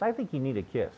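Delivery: soft, gentle offer of a kiss; tender invitation. Retold or imitated. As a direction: coax him softly out of sulking. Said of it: "she came over to me and she goes".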